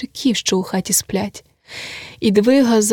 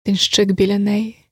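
ordinary delivery

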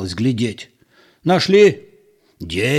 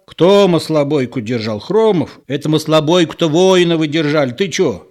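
indignant contradiction; 5 semitones higher, 4 dB louder than usual. A narrator's reading.

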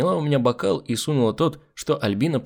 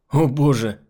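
louder, higher startled exclamation; startled cry; 4 semitones higher, 5 dB louder, slower than usual. A narrator's reading. Man seeing the hostess in the light, crying out. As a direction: cry out in mild shock. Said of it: "увидел Альбину и вскрикнул".

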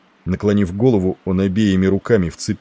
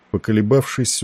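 usual speaking voice

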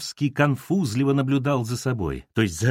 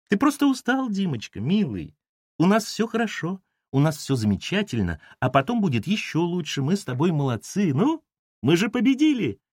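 false cooing; 7 semitones higher than usual. A narrator's reading.

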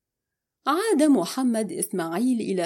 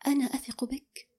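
soft, higher, hesitant voice; a touch of gentle bewilderment; puzzled; 4 semitones higher, 6 dB quieter than usual. A narrator's reading. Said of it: "ابتسمت وقالت في حيرة".

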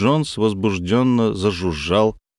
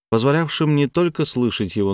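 usual speaking voice

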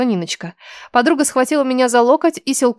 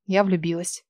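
отрезала; 4 semitones lower, 8 dB quieter than usual. Acted. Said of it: "отрезала я".

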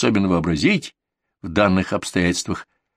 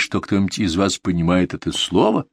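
ordinary delivery, nothing marked

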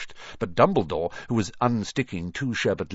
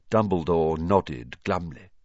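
ordinary delivery

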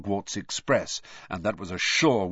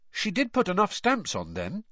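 feigned innocence; 8 semitones higher than usual. A narrator's reading. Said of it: "trying to sound as though Missus Weasley's was a mistake anyone could make".

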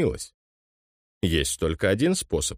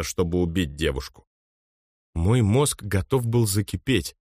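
ordinary delivery

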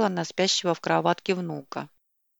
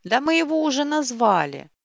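bright, happy answer; joyful; 7 semitones higher, 4 dB louder than usual. A narrator's reading. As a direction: say it gladly, a little higher. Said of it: "также радостно ответили".

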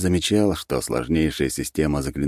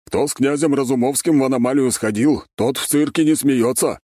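lightly performed borrowed saying, pitched higher; parroted catchphrase; 8 semitones higher, 3 dB louder than usual. A narrator's reading. Alarak shows off an overheard saying to his companions, parroted catchphrase. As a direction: recite it like a phrase picked up somewhere, voice raised. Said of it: "явно скопировав услышанную где-то фразу".

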